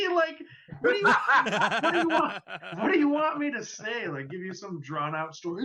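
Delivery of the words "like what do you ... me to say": high, laughing what do you want; laughing at the cashier's question; laughing exasperation. Real, not acted. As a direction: laugh through a mock protest.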